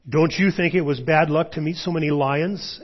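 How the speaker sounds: high, loud indignant question; aggrieved boy arguing; boyish protest. A narrator's reading.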